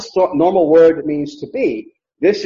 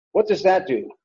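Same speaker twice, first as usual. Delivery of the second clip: usual speaking voice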